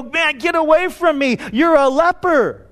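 high, disdainful brush-off with a sneer; condescending. Pretend. Jesus mocked as shooing away a leper, condescending. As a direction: push the leper away with contempt. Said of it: "He was not condescending to him".

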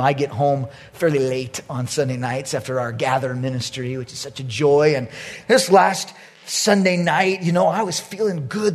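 usual speaking voice

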